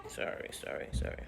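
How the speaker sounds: whispered apologies through tears; barely voiced sorry while crying; tearful whisper. Real, not acted. Apologizing to viewers while crying, whispered. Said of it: "See, she's upset too".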